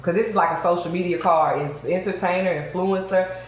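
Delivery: ordinary talk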